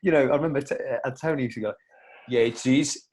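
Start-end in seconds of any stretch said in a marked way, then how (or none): 2.3-3.1 lightly put-on Italian accent, rising at the end; a hint of Italian accent on cheese. Antonio toasting with the lads, light Italian accent. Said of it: "in his Italian accent".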